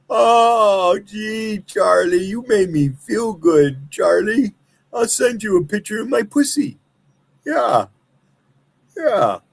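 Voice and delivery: high, breathy-sounding simpering parody; mocking girly voice. Continues mocking woman voice.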